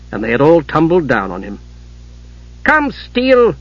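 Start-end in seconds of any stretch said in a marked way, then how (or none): 2.6-3.5 high, sharp command; sharpened voice. A narrator's reading. Mother losing patience with her son, sharply. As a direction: order it sharply, pitch jumping up. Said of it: "Kitiara's voice sharpened".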